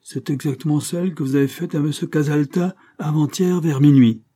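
the inspector accusing the murderess, harshly. A narrator's reading. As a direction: strike back hard. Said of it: "parla assez durement".

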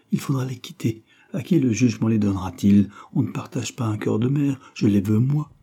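ordinary delivery

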